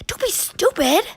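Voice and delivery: high, strained yell; panicked yell. Acted. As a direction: shout her down in alarm. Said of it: "Richie screamed under his breath".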